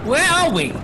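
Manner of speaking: high, startled questions; frightened traveller asks where he is; alarmed. Acted. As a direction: break in with sudden alarm. Startled traveller in rocking coach.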